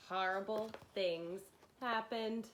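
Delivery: usual speaking voice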